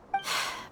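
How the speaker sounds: a quiet, resigned sigh; soft sigh. Acted. Dia sighing quietly about what she owes the boss. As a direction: breathe out softly before admitting it. Dia speaks of her debt.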